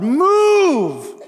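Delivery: high, loud, drawn-out cry of move; a desperate shout to heaven, held long. Retold or imitated. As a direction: cry out to God with everything. Isaiah crying out to God.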